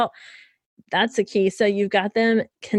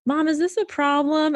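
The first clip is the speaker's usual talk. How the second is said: put-on child's question; 6 semitones higher than usual. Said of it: "my three-year-old will say".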